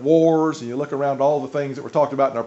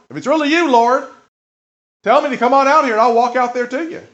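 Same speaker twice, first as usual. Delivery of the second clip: higher, louder, eager calling out to Jesus; eager plea; 7 semitones higher, 6 dB louder than usual. Acted. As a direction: Peter daring to ask, pitched up. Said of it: "Peter says".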